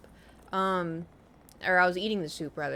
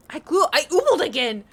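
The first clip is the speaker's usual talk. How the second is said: loud exclamation; 7 semitones higher, 9 dB louder than usual. Real, not acted. Spits again while laughing.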